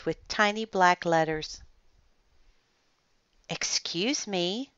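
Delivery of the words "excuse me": hesitant, softly quavering woman; meek, drawn-out, trembly politeness. A narrator's reading. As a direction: speak up timidly, slow and soft. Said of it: "in a soft, trembly voice".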